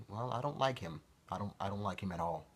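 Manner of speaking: usual speaking voice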